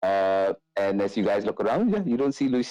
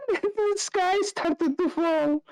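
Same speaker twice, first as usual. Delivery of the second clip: very high, drawn-out giggly squeal; laughing; slower than usual. Acted. Irina recalls the failed festival, high laughing voice. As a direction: squeal it slowly through laughter. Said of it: "Arena just kinda laughs".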